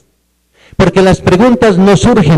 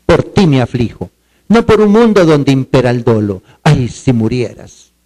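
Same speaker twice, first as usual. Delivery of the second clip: poem recital; 5 semitones lower than usual. Acted. Teacher reciting a tender poem to the class, solemn.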